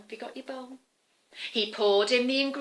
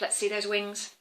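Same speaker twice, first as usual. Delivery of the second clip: usual speaking voice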